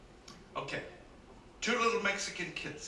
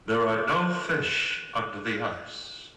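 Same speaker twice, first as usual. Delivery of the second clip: deep, slow, loud booming voice from the sky; a lower, slower voice from above; 7 semitones lower, 6 dB louder, slower than usual. Acted. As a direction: boom it down like a god from the heavens. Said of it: "they hear a voice from above".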